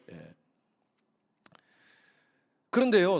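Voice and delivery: ordinary talk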